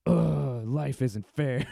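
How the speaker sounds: slow, dragged-out petulant groan; mock whining. Bitter L A hopeful complaining, exaggerated whine. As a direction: moan it like a sulking sore loser. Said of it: "weird competitive, like".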